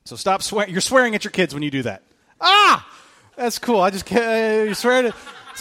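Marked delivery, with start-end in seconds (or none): 2.4-2.9 surprised shout. Real, not acted. Sudden realization mid-sermon.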